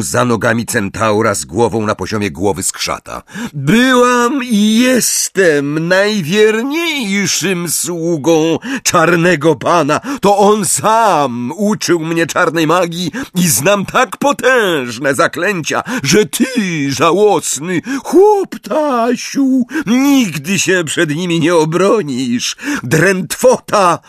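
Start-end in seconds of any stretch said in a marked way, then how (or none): 3.5-22.6 high, slow, emphatic declaiming; high-pitched, drawn-out scorn; proud villainous boast. A narrator's reading. Villain boasts mid-duel. 22.8-24.0 high, drawn-out spell shout; forceful raised-pitch cry; roared. A narrator's reading. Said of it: "ryknął Harry".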